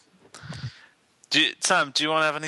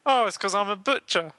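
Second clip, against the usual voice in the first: high frog puppet voice; Kermit the Frog; 6 semitones higher than usual. Acted. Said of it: "all right, Kermit".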